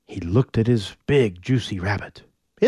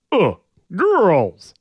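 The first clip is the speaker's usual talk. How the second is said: caveman grunt; 6 semitones higher, 3 dB louder than usual. Acted. Orion grunting again.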